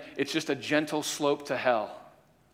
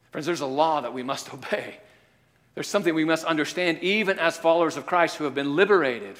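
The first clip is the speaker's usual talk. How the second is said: ordinary delivery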